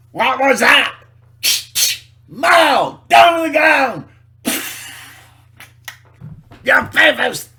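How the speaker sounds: high, loud barked orders; shouting soldier. Acted. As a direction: yell commands like a raiding guard. Acting an authoritarian raid.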